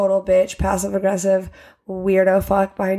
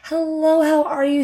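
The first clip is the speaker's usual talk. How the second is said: high, sweet fake-polite hello; fake friendly greeting; 7 semitones higher than usual. Mocking the Karen neighbor's sugary hello. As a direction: greet sweetly but insincerely. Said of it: "it's like".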